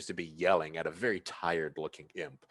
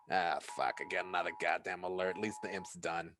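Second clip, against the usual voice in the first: usual speaking voice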